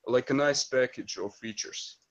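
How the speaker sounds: ordinary delivery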